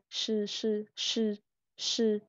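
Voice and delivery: "sư" as sh, no extra puff; unforced retroflex sh. Shown as a demonstration. Teacher shows sh needs no push, plain retroflex "sư". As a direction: say "sư" normally with the tongue curled. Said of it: "S H thì mình không phải bật hơi".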